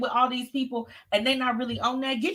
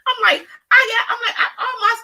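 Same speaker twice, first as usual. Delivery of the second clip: high, loud excited outburst; excited shout; 13 semitones higher, 7 dB louder than usual. Real, not acted. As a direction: burst out in disbelief. Exclaiming at nasty listeners.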